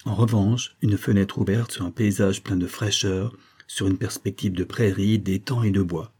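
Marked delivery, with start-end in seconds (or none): none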